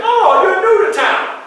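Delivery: high, slow, loud cheerful greeting; friendly stranger. Retold or imitated. Lassiter hailing the newcomer in town, high and cheerful.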